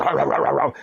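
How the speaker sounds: rapid run of rough barks; imitated dog barking. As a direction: bark like a warning dog. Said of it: "And he barked".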